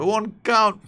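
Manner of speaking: sudden high, loud yelp of glee; excited outburst. Real, not acted. Gamer thrilled by a fluke goal, high excited yelp.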